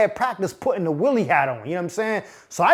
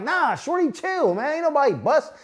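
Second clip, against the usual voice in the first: defensive denial; 6 semitones higher, 3 dB quieter than usual. Retold or imitated.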